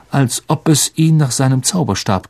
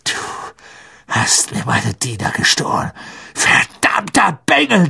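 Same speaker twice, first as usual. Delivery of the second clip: wütende Beschimpfung; 4 semitones higher than usual. A narrator's reading. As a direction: build from contained rage to a flung insult. Malfoy lunges at Harry in fury. (erst tief grollend, dann hoch aufbrausend)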